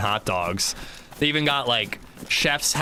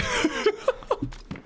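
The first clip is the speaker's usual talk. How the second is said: high giggle; 4 dB quieter than usual. Retold or imitated. After voicing toddler.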